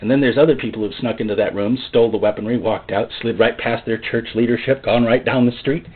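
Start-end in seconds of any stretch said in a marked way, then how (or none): none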